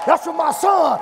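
high, loud cry of praise; shouted dedication. Real, not acted. Preacher dedicates praise to his son, high loud shout. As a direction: shout it out for family.

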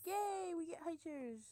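high, drawn-out happy yay; excited cheer. Real, not acted. Gamer getting high chairs in the game, cheering. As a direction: celebrate a new unlock, slowly and loudly.

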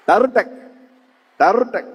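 short, percussive rolled sound effect; imitated rattle. Storyteller imitates a new sound at the fair, percussive rattle. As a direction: roll and snap it like a noisemaker. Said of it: "இன்னொரு சத்தம் கேக்குது".

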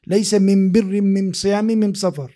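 "من بر من صيام من سفر" with the am- article in place of al-, said "am-birr, am-siyam, am-safar"; "من بر من صيام من سفر" said with the Himyar tribe's am- article; Himyari dialect. Shown as a demonstration. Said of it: "in his own accent".

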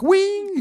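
high, drawn-out cartoon squeal; cartoon "whee". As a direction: squeal it like a cartoon zipping past. Imitates a cartoon zooming dodge.

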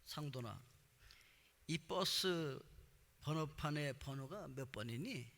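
slow, low taunt with the numbers stretched out; low-key, drawn-out mock teasing. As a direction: imitate a friend needling, drawing out the last word. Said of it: "저를 놀리기 위해서".

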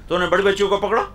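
ordinary talk

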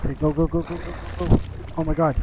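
ordinary talk

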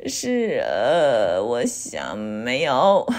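slow, halting low hesitation; low drawn-out hemming and hawing; stammering. A narrator's reading. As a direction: drag out a sheepish, unsure answer. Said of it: "我结结巴巴地说".

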